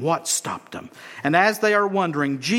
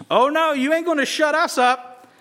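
higher, cocky refusal to be hushed; defiant beggars; 9 semitones higher than usual. Acted. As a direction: dig in and talk back. Blind men defiant.

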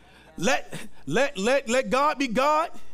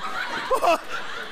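breathy laugh; 9 semitones higher than usual. Real, not acted. Laughing at his own fight story.

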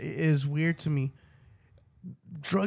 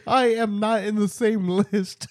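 high, loud laughing words; 5 semitones higher, 5 dB louder than usual. Real, not acted. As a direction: laugh while getting it out. Laughing through denial.

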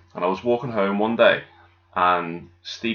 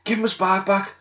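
mock woman scolding; 11 semitones higher than usual. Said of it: "she was like".

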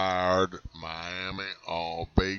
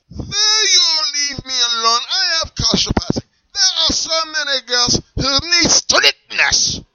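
put-on high, loud German accent, indignant; strict German seller shooing him off; 10 dB louder, slower than usual. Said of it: "your German accent".

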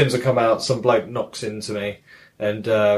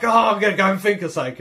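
higher, quick, eager burst; mock happy excitement; 8 semitones higher, faster than usual. Retelling Majima happy at chance to fight, mock excitement. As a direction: rush it out like a delighted schemer. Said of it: "really happy that he's gonna".

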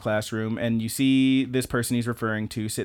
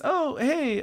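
much higher cheery greeting; put-on high hello; Misumi. Classmate greeting someone who walks in, high-pitched. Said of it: "he says".

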